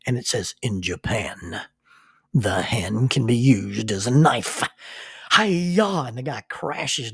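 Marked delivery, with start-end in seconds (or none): 0.6-4.6 slow, hushed whisper of a dramatic ad voice; overdramatic commercial. Retold or imitated. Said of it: "this overdramatic commercial". 5.3-6.0 higher, louder mock karate shout; karate yell. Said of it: "this overdramatic commercial".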